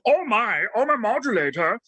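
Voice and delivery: lightly voiced little cartoon Martian; a touch of the fussy Martian whine; Marvin the Martian. Shown as a demonstration. Actor offering to voice Marvin the Martian, light impression. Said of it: "a Marvin the Martian".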